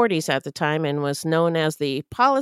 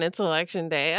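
usual speaking voice

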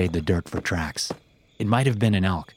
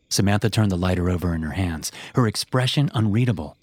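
usual speaking voice

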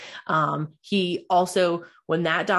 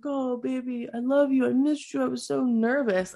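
wife mocks her groggy husband after surgery, mock doting; 7 semitones higher than usual. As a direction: imitate him mooning over her after surgery. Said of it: "all groggy and he's like".

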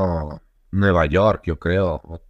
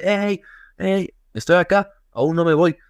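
high, urgent call to someone driving off; high-pitched hey hey, waving someone down; calling out after a car; 8 semitones higher than usual. Retold or imitated. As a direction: shout after the car so the friend stops. Running after friend's car calling.

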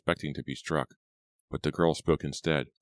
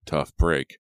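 louder, mildly callous retort; nasty; 7 dB louder than usual. A narrator's reading. Man brushes off captive's pain, mildly nasty. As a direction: dismiss her with some spite. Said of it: "the man replied nastily".

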